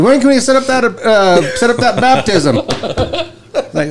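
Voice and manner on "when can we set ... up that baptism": put-on eager kid voice. Foster kids pushing for adoption.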